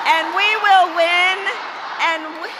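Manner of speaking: chanting rally refrain; rally speech. Real, not acted. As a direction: lead the crowd chant. Crowd chant we will win.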